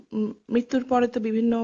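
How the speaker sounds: ordinary talk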